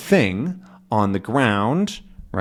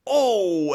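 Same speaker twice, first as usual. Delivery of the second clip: high sudden cry of pain; 10 semitones higher than usual. Retold or imitated. Captain kicks the thing, a high pained oh. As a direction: yelp as the toe hits. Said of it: "and went".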